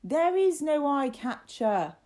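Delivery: loud, high, drawn-out shout; frustrated shout. A narrator's reading. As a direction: shout each word in exasperation. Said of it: "shouted Martin".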